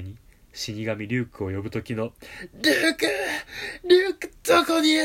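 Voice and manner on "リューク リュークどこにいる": very high, loud breathy calling; desperate Kira cry. Retold or imitated. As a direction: call out gasping, at the end of your rope. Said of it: "吐息混じりにポール気味".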